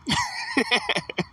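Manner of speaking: high, quiet laughter. Real, not acted. Laughing at his own preacher impression, high soft laugh.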